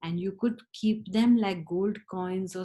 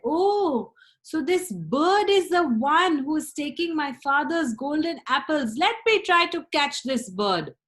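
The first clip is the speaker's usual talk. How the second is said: high, loud eager discovery; thrilled to have found the thief; loud eager young hero; excited Ivan; 7 semitones higher, 5 dB louder than usual. Acted.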